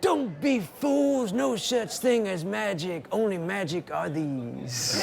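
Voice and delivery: slow, high, drawn-out mock boxer voice; Muhammad Ali. Ali teasing the room after the trick, slow and high. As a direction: deliver the punchline slowly like the champ. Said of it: "And he goes".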